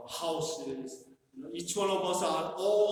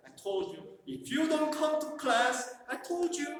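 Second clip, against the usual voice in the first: scolding teacher; 8 semitones higher than usual. Acted. Scolding kids who skip class.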